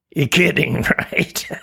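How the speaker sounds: whispered, laughing taunt; mocking laugh. Imp laughing at Tina in a whisper. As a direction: scoff at her in a laughing whisper.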